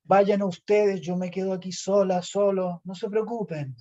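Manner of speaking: high, self-pitying sigh of a sacrifice; mock-tragic high voice; theatrical martyr. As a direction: play the brave abandoned one, overacted. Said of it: "Casi teatral de los cuatro".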